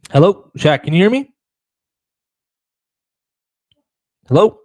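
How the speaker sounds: loud high call. Real, not acted. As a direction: call out to get his attention. Calling guest who is silent.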